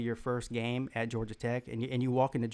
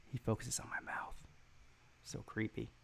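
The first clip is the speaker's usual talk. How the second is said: hushed, mock-creeped-out whisper; 2 semitones lower, 13 dB quieter than usual. To the chat, a mock-creeped-out whisper about the viewer. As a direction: breathe it like a spooked aside.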